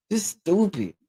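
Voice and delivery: usual speaking voice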